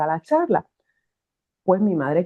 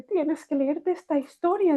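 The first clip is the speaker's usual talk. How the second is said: lightly voiced mother, higher and slower; a touch of an insistent mum, pitched up; mother's voice; 9 semitones higher, 3 dB quieter, slower than usual. Mother urging her daughter to read the story, lightly voiced and higher.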